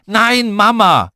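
high, loud, drawn-out yell; a little girl's slow, stretched scream; screamed refusal. A narrator's reading. Lotti refuses dad and wants her mother, screaming. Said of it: "Und Lotti schrie".

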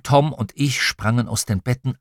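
plain reading voice

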